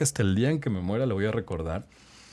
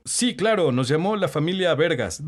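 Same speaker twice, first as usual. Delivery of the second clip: a higher, louder announcer voice, eager and stiff; nervous radio announcer; 7 semitones higher, 4 dB louder than usual. Acted. New phone operator's first words on air, in a higher, louder announcer voice. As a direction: put on a big radio voice, a little too eager.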